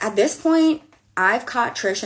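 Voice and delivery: high, loud exasperated burst; exasperated outburst. Real, not acted. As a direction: throw your hands up. Exasperated rant peak.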